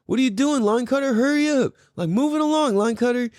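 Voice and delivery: high, singsong mock call; retold heckling. Driver who wasn't being mean, heckling the line cutter. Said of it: "I just kept saying".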